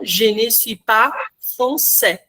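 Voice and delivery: "Je ne suis pas français" with the S of "pas" silent, slow and clear; silent final S. Shown as a demonstration. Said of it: "desapega completamente das consoantes finais".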